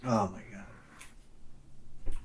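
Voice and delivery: whispered, slow savouring; whispered awe. Real, not acted. Sipping good coffee, a slow whispered oh my God. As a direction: breathe it out slowly over the coffee.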